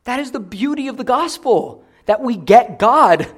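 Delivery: high proclamation, swelling to a loud finish; exultant preacher's climax; full-force preaching. Real, not acted. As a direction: proclaim it, stretching the last words.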